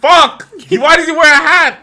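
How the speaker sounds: high, loud exclamation; worked-up, high-pitched shouting; exasperated outburst. Real, not acted. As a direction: blurt it out loudly in exasperation. Exasperated outburst over the hat.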